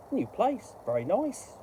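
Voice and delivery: high squawky parrot line. Acted. Parrot in the joke eyeing its new home, high parrot voice. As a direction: pitch it up like a talking parrot.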